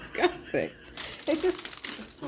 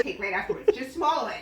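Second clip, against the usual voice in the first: ordinary talk, nothing marked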